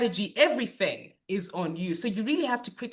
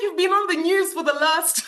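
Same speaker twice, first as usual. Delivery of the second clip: laughing through words; 8 semitones higher, 5 dB louder than usual. Retold or imitated. Laughing while retelling friends' news.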